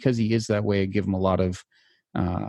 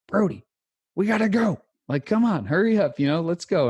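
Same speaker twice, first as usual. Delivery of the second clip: high, urgent barked orders; drill sergeant; 9 semitones higher than usual. Retold or imitated. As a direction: hurry the kid like a drill instructor. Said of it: "the drill instructor voice inside of me".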